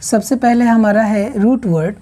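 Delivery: ordinary delivery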